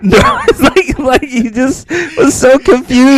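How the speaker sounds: squealing high through laughter; laughing. Real, not acted. Friend laughing about the villain's confusion, squealing. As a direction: laugh the words out.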